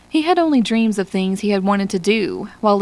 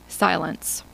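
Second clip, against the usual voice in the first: ordinary delivery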